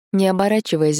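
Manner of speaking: usual speaking voice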